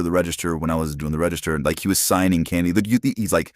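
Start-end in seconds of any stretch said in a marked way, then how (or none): none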